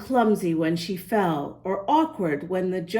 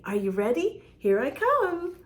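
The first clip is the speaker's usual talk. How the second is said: ordinary delivery, nothing marked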